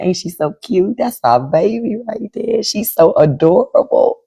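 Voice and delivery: high, slowed-down gushing over a little one; auntie mode. Real, not acted. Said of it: "I'm gonna be in auntie mode".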